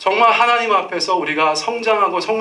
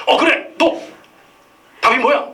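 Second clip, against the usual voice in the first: teacher calling on pupil; 8 semitones higher, 4 dB louder than usual. Retold or imitated. Teacher calls on the boy.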